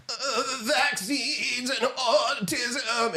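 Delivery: slow, soft, high mock voice with a laugh; imitating Robert F Kennedy Junior. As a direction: parody him in a soft, high, dragged-out voice through a laugh. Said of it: "Robert F Kennedy Junior saying".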